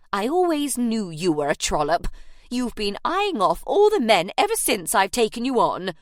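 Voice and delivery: loud spiteful jeer. Acted. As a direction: spit the insult out for all to hear. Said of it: "in a loud, spiteful voice".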